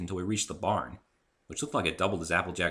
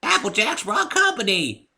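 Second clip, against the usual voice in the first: high, slow creaky granny call; hollering old mare; creaky old woman; 11 dB louder, slower than usual. A narrator's reading. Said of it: "called the voice".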